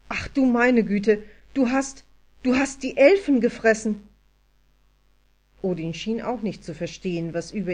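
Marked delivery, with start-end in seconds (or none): 0.1-4.1 loud, raised-pitch horror; aghast outburst. A narrator's reading. Anja realises Odin ate the elves, aghast and loud. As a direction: burst out in appalled disbelief. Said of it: "Anja schlug fassungslos die Hände vors Gesicht".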